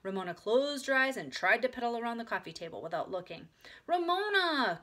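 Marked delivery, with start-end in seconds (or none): 3.9-4.8 high, loud call; crying out. A narrator's reading. Big sister calls to Ramona, crying out. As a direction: call out sharply to stop a child. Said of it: "cried Beezus".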